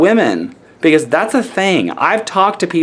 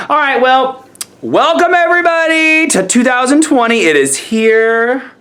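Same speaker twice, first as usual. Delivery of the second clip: excited welcome; 10 semitones higher, 3 dB louder than usual. Real, not acted. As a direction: welcome everyone in a soaring, excited voice. Celebratory welcome to new year.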